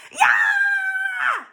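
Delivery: piercing high scream. A narrator's reading. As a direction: shriek from a hard yank. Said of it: "screamed Leslie".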